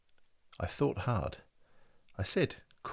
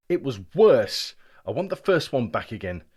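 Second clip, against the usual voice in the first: angry complaint; 3 semitones higher, 8 dB louder than usual. Acted. Boy protesting loudly to the clock, indignant. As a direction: demand it back, loud and fed up.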